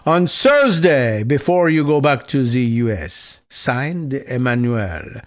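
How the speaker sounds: hammed-up French accent, "th" turned to "s" or "z"; Emmanuel Macron. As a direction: sign off the letter in a mock French accent. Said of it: "trying to do a French accent".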